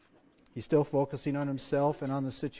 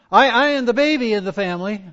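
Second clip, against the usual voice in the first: mock complaint, higher and louder; lightly acted pleading of the family baby; Gideon's excuses; 7 semitones higher, 12 dB louder than usual. Acts out Gideon's self-focused excuses.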